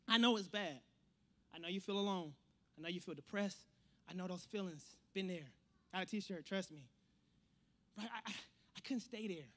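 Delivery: low, soft, confiding; empathetic. Real, not acted. Preacher to those feeling alone and depressed, soft and empathetic. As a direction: drop to a gentle, understanding hush.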